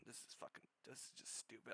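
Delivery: very soft, deflated and breathy after a sigh; exasperated mutter. Real, not acted. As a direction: mutter it, worn out by the stupidity. Worn out after the rant.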